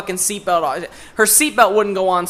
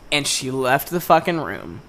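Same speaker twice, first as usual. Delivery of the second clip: ordinary talk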